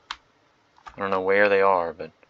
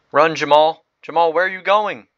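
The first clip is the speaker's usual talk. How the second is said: higher, louder, urging a player on; excited yelling; 7 semitones higher, 8 dB louder than usual. Real, not acted. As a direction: shout after Jamal to run. Cheering on a soldier.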